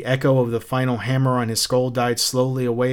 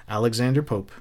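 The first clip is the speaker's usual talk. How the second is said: ordinary delivery, nothing marked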